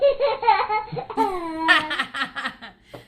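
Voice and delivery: bursting into a loud laugh. Real, not acted. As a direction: let the giggle roll out loud. Laughing at her own joke.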